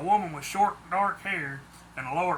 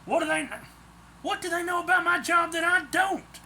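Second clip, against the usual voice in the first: indignant question; 12 semitones higher than usual. A narrator's reading. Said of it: "I asked as I took aim".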